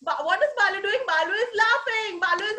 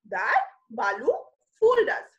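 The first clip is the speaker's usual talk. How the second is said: usual speaking voice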